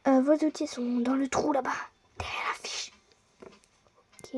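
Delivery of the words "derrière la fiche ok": soft unvoiced whisper. Guide whispers where the tools are hidden. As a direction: breathe it secretly.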